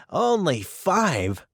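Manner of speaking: slow, amused and teasing; laughing question. A narrator's reading. Dickon teasing Mary about only five friends, amused. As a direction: tease her through a laugh. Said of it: "laughed Dickon".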